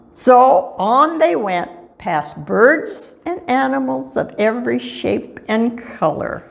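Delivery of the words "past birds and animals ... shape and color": ordinary delivery, nothing marked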